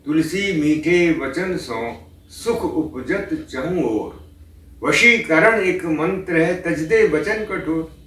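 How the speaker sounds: slow, sung-through couplet recitation; recited doha. Acted. Preacher reciting Tulsidas couplet on sweet speech, slow intoning. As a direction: draw out the couplet slowly.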